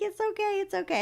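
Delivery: high, sing-song reassurance; extra sweet okay. Retold or imitated. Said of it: "So I was just like".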